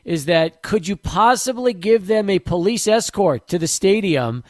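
normal talking voice

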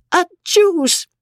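high explosive mock sneeze; sudden high-pitched acted sneeze; convulsive sneeze. A narrator's reading. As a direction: burst out with a spoken "achoo". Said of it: "a series of convulsive".

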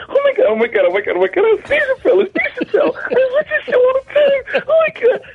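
very high squealing panic, breathless with laughter; starstruck squeal. Retold or imitated. Fan inwardly squealing over meeting Dave Chappelle, high-pitched panic. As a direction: freak out inside like a fan. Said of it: "In the back of my mind".

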